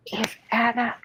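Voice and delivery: faint, breathy whisper from a sickbed; hoarse whisper. A narrator's reading. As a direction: whisper it weakly, feverish. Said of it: "whispered Elizabeth hoarsely".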